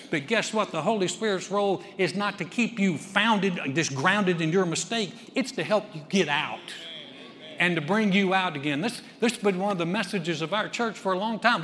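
loud, high impassioned preaching; preaching at full force. Acted. Pastor urging the congregation, in loud impassioned preaching. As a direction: build the sermon to full force.

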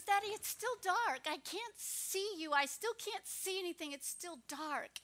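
put-on a frightened child pleading with her daddy at bedtime; scared little girl.

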